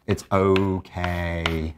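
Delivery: "okay" stretched right out, slow and low; slow self-reassurance. Shown as a demonstration. Teacher showing how to acknowledge your feelings, slow and soothing. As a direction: draw out "It's okay" very slowly, soothingly. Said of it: "acknowledge the feelings and basically say".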